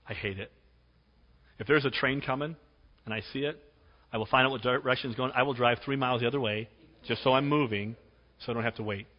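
ordinary delivery

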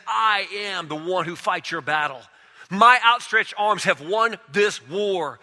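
high, loud proclaiming in God's voice; full-force preaching. Acted. Preacher voicing God to the church, loud and high. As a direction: thunder it out as God's own claim.

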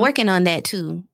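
ordinary talk, nothing marked

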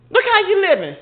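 ordinary talk, nothing marked

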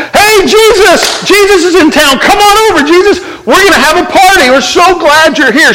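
high, bubbly Martha calling Jesus over; excited party hostess. Retold or imitated. Martha calls out to Jesus to come party, high and gushing. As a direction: squeal with delight inviting him in. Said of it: "She's a person that".

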